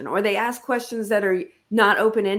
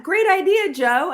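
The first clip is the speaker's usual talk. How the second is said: high, louder burst of approval; mock enthusiastic praise; 10 semitones higher, 4 dB louder than usual. Boss crediting Joe for her idea, mock enthusiasm. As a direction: gush over the idea in imitation.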